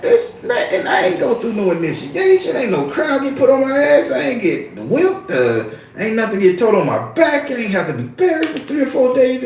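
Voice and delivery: high-pitched comic disbelief; incredulous protest. Retold or imitated. Storyteller protesting he never got initiated, high-pitched disbelief. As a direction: squeal out a mock objection.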